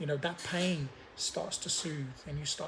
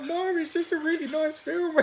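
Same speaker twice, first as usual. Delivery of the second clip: higher, louder, flustered brush-off; mock denial; 12 semitones higher, 6 dB louder than usual. Denying tears to Lucy, hammed-up higher protest. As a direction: pretend you're not crying at all. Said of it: "I'm like".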